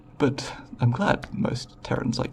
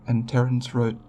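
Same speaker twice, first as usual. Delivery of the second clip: usual speaking voice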